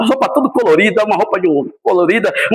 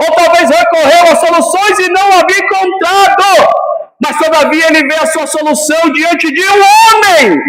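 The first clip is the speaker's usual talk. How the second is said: fiery preaching; 8 semitones higher, 4 dB louder than usual. Acted. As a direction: preach it to the whole church, building. Preaching at full force. (pregação inflamada, alta e gritada)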